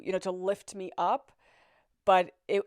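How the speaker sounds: ordinary talk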